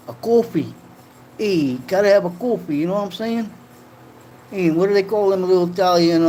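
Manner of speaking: high-pitched Italian-American wise guy; mock Italian-American accent. Streamer riffs on having coffee, hammed-up Italian-American accent. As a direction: ham up an East Coast Italian accent. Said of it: "I am Italian".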